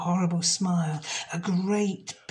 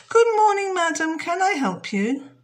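much higher, louder sweet greeting; polite shop girl; 12 semitones higher, 7 dB louder than usual. Acted. Jenny greets the witch, high and loud. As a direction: put on your best manners. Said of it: "But she said".

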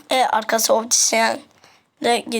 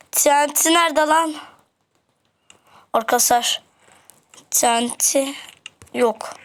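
ordinary talk

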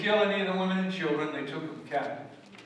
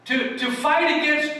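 raised, ringing preacher voice, higher and louder; full-force preaching; 7 semitones higher, 4 dB louder than usual. Acted. Preacher to his congregation, booming and raised. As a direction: proclaim it to the whole congregation.

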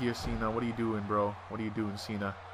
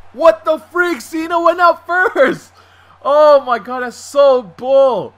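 high, loud outraged yell; outraged shout; 10 semitones higher, 11 dB louder, slower than usual. Real, not acted. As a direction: yell in disbelief at the screen. Game cheats him, outraged outburst.

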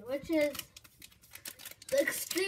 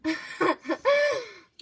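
surprised laugh; 4 semitones higher, 5 dB louder than usual. Real, not acted. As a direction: burst of laughter. Sweet candy surprise laugh.

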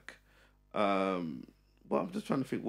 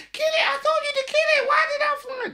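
very high, squealed mimicry; panicked partner; 4 dB louder than usual. Partner scared of a fly demanding he kill it, mock shriek. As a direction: imitate a frantic high-pitched squeal.

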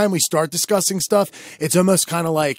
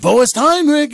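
higher-pitched mock call-out; retold yell; 10 semitones higher, 4 dB louder than usual. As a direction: call out in a raised, high voice, retelling it. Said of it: "and I yelled out".

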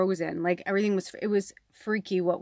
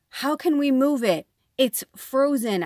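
loud, higher-pitched retort, slowing at the end; exasperated protest; 6 semitones higher, 6 dB louder than usual. Retold or imitated. Retelling her protest to the landlord, exasperated and loud. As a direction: throw the question back in disbelief.

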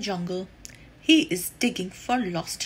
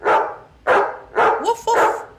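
puppy bark; 9 semitones higher, 5 dB louder than usual. Acted. Pup chases the snake away.

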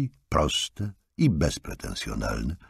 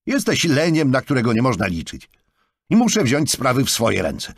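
berating; 9 semitones higher, 5 dB louder than usual. A narrator's reading. Jonathan berates Markus.

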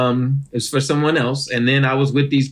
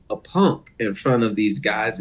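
usual speaking voice

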